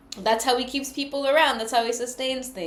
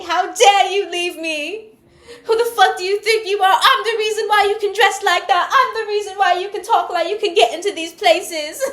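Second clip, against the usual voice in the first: high, loud, outraged British rant; mock British accent; 9 semitones higher, 7 dB louder than usual. Spurned ex claiming credit for your success, British-accented fury. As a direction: rant indignantly in hammed-up British. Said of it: "I don't know why I'm talking like that".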